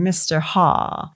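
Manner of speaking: put-on thick New England-German accent, HA with no L; slow imitation of a thick accent, Hall becoming "Ha". Quoting Warwick's name for Hall, thick accent drops the L. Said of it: "he's got this thick accent and he's saying".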